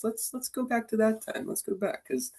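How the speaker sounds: high, soft coaxing voice; gentle coaxing. Retold or imitated. Coach reminding a fidgety kid he can do it, gentle coaxing. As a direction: coax a child lightly and softly.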